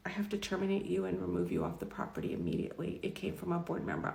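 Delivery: soft, subdued retelling of a firing; hushed dismissal. Retold or imitated. HR manager terminates employee on board's orders, hushed. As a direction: quote the dismissal very quietly.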